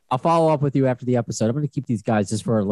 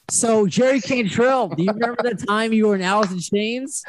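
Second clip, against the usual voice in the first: Chris Farley impression; 11 semitones higher than usual. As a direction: gush like a nervous superfan. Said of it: "that's me, Chris Farley".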